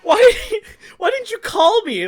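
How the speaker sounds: very high, laughing disbelief; incredulous. Retold or imitated. Friend asking why he never called, incredulous and exasperated.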